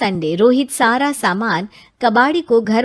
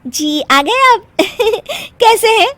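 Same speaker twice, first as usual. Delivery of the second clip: giggly greeting; 12 semitones higher, 4 dB louder than usual. Acted. Wife greets husband giggling.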